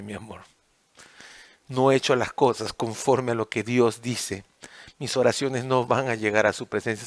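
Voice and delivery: humble apology to wife. Acted. Husband begging his wife's forgiveness, soft and low. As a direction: ask forgiveness quietly, head bowed. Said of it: "Ir a buscar a mi esposa y decirle".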